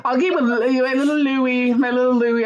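sing-song high "Louis" said LOO-ee; playful mispronunciation. Shown as a demonstration. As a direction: coo "my little Louis" as LOO-ee. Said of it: "butcher it with my American accent".

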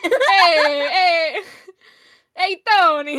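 put-on New Yorker accent. Hammed-up New Yorker calling out to Tony, loud New York accent. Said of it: "New Yorker? All right".

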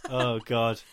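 ordinary talk, nothing marked

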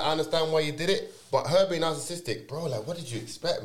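ordinary talk, nothing marked

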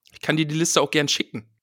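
ordinary talk, nothing marked

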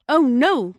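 high, loud wail of dismay; agonized cry. A narrator's reading. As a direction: cry out in dread. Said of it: "cried in the darkness an agonized voice".

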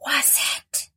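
eerie mysterious ghost whisper; hollow ghostly whisper. Acted. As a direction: breathe it like a ghost at his ear. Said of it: "whispered a hollow voice in a deep, mysterious tone".

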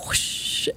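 drawn-out hushed whoosh; imitated whoosh. Telling how her water broke, imitated whoosh. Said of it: "it just goes".

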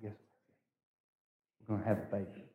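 hushed confiding. Retold or imitated. Confiding news to a friend in story.

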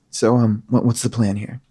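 low NPC voice demanding the plan. Acted. DM as crossbow villain asking for the plan, low voice. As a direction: drop low and ask it flatly.

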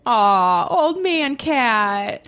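slow, tender coo. Real, not acted. Cooing over an old cat.

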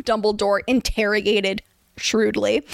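ordinary delivery

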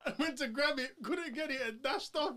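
laughing through the words, voice lifted. Real, not acted. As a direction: chuckle while telling it. Laughing while retelling a fight.

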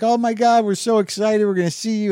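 high, thrilled fan gush; imitating a thrilled fan dad; excited dad. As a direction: gush like an excited dad meeting his hero. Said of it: "He's like".

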